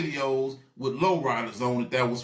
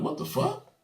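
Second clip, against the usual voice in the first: high, breathy-free squeak of shock; a sudden high what-the; stunned disbelief; 4 dB quieter than usual. Retold or imitated. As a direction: react like you can't believe your eyes. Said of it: "I'm like".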